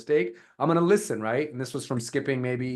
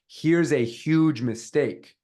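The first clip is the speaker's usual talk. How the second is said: slow, punchy "huge mistake"; emphatic hook; 4 dB louder, much slower than usual. Shown as a demonstration. Coach demos a hook to stop scrollers, emphatic. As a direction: open slow and firm, leaning on "huge mistake". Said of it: "spell that differently and get people to".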